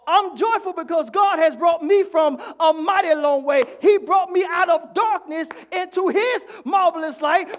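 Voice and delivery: shouted, high preaching climax; preaching at full force. Acted. Preacher to the congregation about his marvelous light, shouting. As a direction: lift the congregation with a roaring build.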